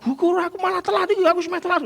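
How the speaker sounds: fast, high, flustered rush; hurried voice. Retold or imitated. A late churchgoer fretting in a fast, high, hurried voice. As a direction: gabble it like you're already late. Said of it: "suarane Anda kesusu-susu".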